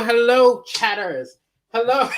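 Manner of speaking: usual speaking voice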